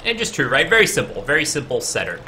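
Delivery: usual speaking voice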